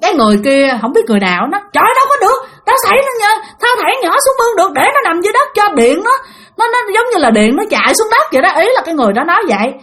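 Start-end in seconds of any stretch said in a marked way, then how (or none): 1.7-8.5 fast, high-pitched insistence; urgent arguing. Retold or imitated. Bystander disputing how to save the child, urgent arguing. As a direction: imitate an agitated bystander arguing back.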